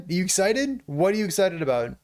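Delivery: ordinary talk, nothing marked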